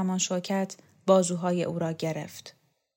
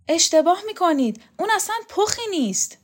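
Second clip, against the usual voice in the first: put-on a touch higher, earnest young student; puzzled young man pressing his point; Borzu's voice; 11 semitones higher, 5 dB louder, faster than usual. Borzu protesting.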